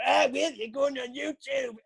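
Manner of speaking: high, slow sing-out taunt; mocking friend. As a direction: imitate a friend gleefully teasing while filming. Mocking friend filming him, acted.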